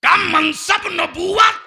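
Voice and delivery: high, full-force pulpit cry; loud sermon climax; proclaiming shout. Acted. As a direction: thunder out the answer to the congregation. Shouts out God's answer.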